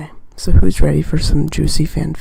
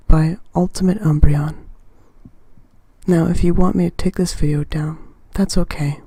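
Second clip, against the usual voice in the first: ordinary talk, nothing marked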